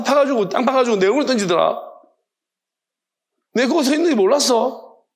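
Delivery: acted exasperated telling-off; reproach. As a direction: take someone to task, pitch climbing. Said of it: "하나님은 무엇으로 책망하시겠습니까".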